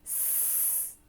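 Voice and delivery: "S" as a long soft hiss. Shown as a demonstration. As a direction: hiss it along together. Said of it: "Can you say that with me".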